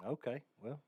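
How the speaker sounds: lightly voiced cocky coach; smug coach voice. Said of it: "and was like".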